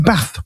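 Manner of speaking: BATH with the short "a" of "cat"; short front vowel in "bath". Shown as a demonstration. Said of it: "It's a short vowel".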